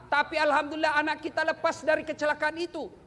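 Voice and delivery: ordinary delivery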